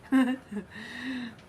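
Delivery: short real chuckle; laugh. Real, not acted. Chatting to stream viewers, amused chuckle. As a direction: chuckle briefly to yourself.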